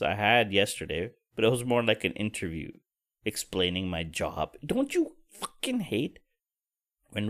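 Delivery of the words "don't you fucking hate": exasperated. Real, not acted. Exasperated complaint.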